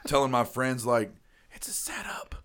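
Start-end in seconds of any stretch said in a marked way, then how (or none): 1.5-2.3 conspiratorial whisper; mock paranoid whisper. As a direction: hush it like a secret plot. Retelling the paranoid warning.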